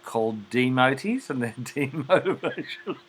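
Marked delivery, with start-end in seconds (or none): 1.3-3.0 amused, laugh bubbling into the words. Real, not acted. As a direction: break into laughter mid-phrase. Amused by own explanation.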